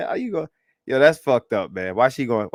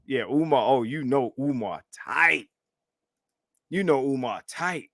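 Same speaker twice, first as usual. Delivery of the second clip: usual speaking voice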